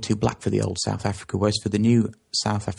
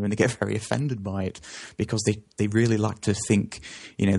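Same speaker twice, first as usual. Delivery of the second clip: normal talking voice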